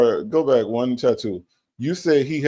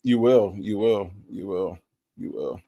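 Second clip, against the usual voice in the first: fading mock sob; 7 semitones lower than usual. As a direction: let each repeat die away. Fading repeated phrase.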